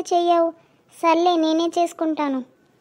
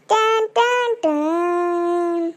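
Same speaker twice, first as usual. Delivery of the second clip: slow drawn-out tan tan tan; dramatic sting; much slower than usual. Acted. Boy imitating the Bigg Boss suspense sound, slow.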